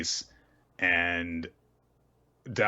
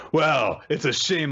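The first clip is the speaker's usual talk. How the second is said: louder, higher haughty put-on; mock taunting; 7 semitones higher, 5 dB louder than usual. Thorny Vine guy belittling Doubt, mock taunting. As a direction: launch the taunt high and loud.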